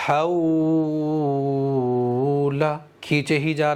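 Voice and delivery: "حولا" with the واو held on very long and slow; overstretched example. Shown as a demonstration. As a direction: drag the واو on and on to show the mistake. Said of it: "واو کو کھینچنا بھی نہیں ہے اس طرح سے".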